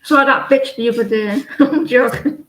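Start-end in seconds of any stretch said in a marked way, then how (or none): 1.5-2.4 laughing. Real, not acted. Laughs after a rude joke.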